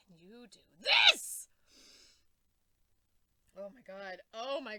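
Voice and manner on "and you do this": much louder sudden accusing burst; angry outburst. Real, not acted. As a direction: explode at him. Outburst at Batman.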